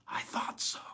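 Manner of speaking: soft unvoiced whisper; a quiet whisper in the dark. A narrator's reading. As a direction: breathe it quietly. Hushed reply in cell.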